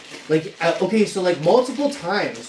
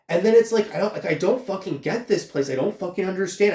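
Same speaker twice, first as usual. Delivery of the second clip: usual speaking voice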